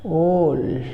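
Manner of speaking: ऑल as a drawn-out "awwl"; teacher's stretched ऑल; long o vowel. Shown as a demonstration. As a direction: hold the rounded vowel in ऑल. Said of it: "क्या पढ़ोगे?".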